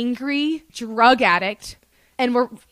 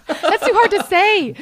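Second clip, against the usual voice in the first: high, loud talking through laughter; real laughter breaking into the words; laughing; 9 semitones higher, 5 dB louder than usual. Real, not acted. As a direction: crack up while protesting. Cracking up over the fake name.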